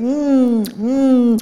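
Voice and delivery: high, loud contented mm-mm; mock yummy hum; imitated mm of eating. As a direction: hum like a hungry child.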